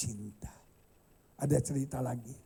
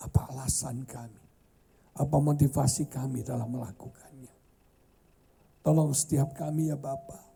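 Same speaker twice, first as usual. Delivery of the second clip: prayer. Real, not acted. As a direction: pray aloud for the whole room. Leads closing prayer.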